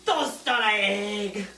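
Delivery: toast on an egg said slow in a mock French chef accent; a slow, hammed-up French chef accent, savouring each word; mock fancy chef accent. Teasing her partner about fancy chef names, in a slow mock French chef accent. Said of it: "'Cause chefs say everything with accents".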